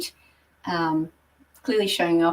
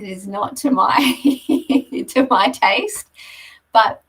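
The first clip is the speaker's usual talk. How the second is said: louder, higher, breaking into a laugh; 4 semitones higher, 7 dB louder than usual. Real, not acted. Presenter admitting the interior design isn't her taste, laughing. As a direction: laugh through the admission. Wry joke about the design.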